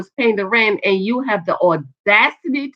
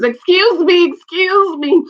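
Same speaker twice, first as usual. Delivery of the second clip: higher, louder call after someone leaving; 8 semitones higher, 5 dB louder, slower than usual. Retold or imitated. Bank worker to customer walking out the door, calling out. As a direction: imitate trying to flag someone down.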